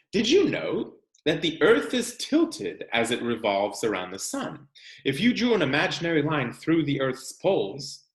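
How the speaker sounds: expressive picture-book read-aloud; reading to children. Acted. Teacher reading a seasons book to her class, expressive read-aloud. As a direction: read it out like story time for the class.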